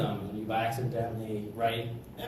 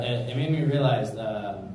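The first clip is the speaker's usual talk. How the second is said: ordinary delivery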